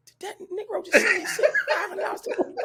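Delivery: high, disbelieving laughing question; can't believe what you just read; shocked dad reading a text in disbelief; incredulous. Retold or imitated.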